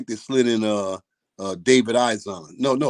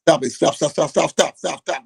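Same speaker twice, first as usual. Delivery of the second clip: raised, rapid-fire repeated command; insistent, higher-pitched shouted-out stop; retold urgent halt; 4 semitones higher, 5 dB louder than usual. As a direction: call off a fight as you retell it. Retold calling off a sparring session.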